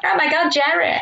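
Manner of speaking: high-pitched starstruck fan greeting. Retold or imitated. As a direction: gush at your idol, in a retelling. Retells greeting Seinfeld as starstruck fan.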